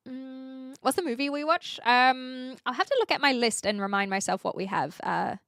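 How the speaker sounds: usual speaking voice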